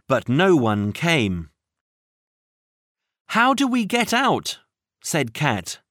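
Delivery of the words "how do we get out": panicked. Acted. Trapped, Cat panics.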